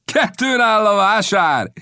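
high, drawn-out gleeful call; captain's mocking shout, words stretched; amused taunting reply. A narrator's reading. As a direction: call back as if laughing it off. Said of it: "felelte a kapitány kacagva".

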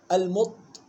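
ordinary talk

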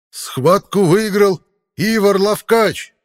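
very slow, loud, high herald cry; town crier. Acted. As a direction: stretch every word over the arena. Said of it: "вскричал глашатай". (протяжный громкий клич глашатая)

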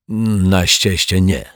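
slow Turk character grumble; grudging, drawn-out mutter from the foreign guest; grumbled reply. A narrator's reading. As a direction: grumble it back slowly, in character. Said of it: "odburknął pod nosem Turek".

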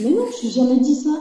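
quick, high-pitched mock denial; retold protest. Wife retells denying it to her husband, protesting. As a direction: protest your innocence, fast and rising at the end.

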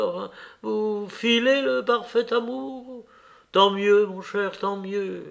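high-pitched, animated society lady; gush, then tease your son; chatty old countess, delighted and teasing. A narrator's reading. (vieille comtesse bavarde et taquine, voix haute)

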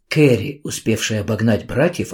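plain reading voice

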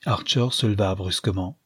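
ordinary delivery

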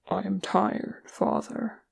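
soft, tired and small; hushed, worn-out voice; weary confession. A narrator's reading. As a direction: admit exhaustion quietly to a father. Weary dwarf confides in his maker.

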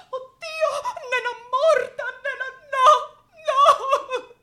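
comic shrieking lament; hammed-up panic. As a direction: play the frantic grief for laughs. Finding her friend seemingly dead.

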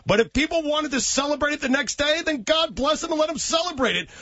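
ranting shout. Real, not acted. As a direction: rant it up, fired up. Heated rant at Olbermann.

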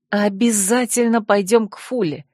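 ordinary delivery, nothing marked

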